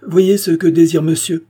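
high, loud, forced gaiety; failed attempt at laughter. A narrator's reading. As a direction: toss it out with false lightness. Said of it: "continua-t-elle en essayant un rire mal réussi".

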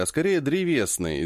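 ordinary delivery

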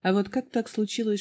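ordinary delivery, nothing marked

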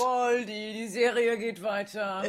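soft call from another room; faint offstage voice; distant call. Acted. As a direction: call from far away.

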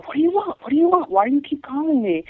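imitating the old woman screaming at her; mock screaming old woman. Caller retelling the old woman screaming at her. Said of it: "screaming at me".

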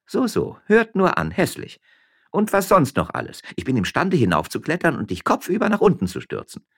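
high, lively miller's wife; Frasquita voice. A narrator's reading. Frasquita teasing her husband up in the vine, high. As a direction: pitch up, playful and teasing.

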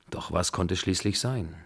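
ordinary delivery, nothing marked

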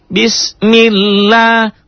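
"bismillah" high, chanted, long "la"; slow recitation. Shown as a demonstration. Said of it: "kok lanya panjang".

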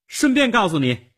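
put-on high, loud girl's call. A narrator's reading. Girl at the hatch calls down to Nick. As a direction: call down to someone below. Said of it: "他对着下面的地窖说".

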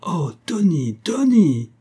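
shocked cry. A narrator's reading. Family seeing Tony dirty and dishevelled, dismayed cry. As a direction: cry out the name in rising dismay. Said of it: "un cri s'élève".

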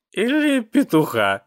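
high, drawn-out cat voice; excited cartoonish tomcat; lewd cat. A narrator's reading. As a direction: draw it out high with lewd glee. Cat's lewd excited joke.

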